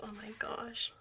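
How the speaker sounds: breathy hushed gasp over a photo; soft stunned whisper; awed whisper. Real, not acted. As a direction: whisper it like you can't believe how pretty. Gushing over idol photos.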